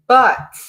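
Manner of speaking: BUT hit hard and loud; stressed but. Shown as a demonstration. Reading the client's letter, stressing "But" loudly. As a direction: punch "But" as the word she dislikes. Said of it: "don't love that word".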